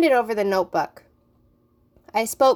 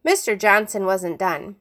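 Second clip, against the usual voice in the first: ordinary delivery, nothing marked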